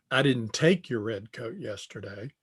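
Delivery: "take" lifted and stressed; rising weight on "take". Shown as a demonstration. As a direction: hit "take", as opposed to borrowed. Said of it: "the inflection on take".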